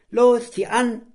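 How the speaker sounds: high, loud urging command; boy yelling at the dog to pull; commanding shout. Acted. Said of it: "verlangte Borki".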